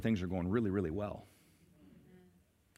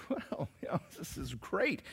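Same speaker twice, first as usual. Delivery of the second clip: pleased pastor; 5 semitones higher, 9 dB quieter than usual. Retold or imitated. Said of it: "the pastor's like".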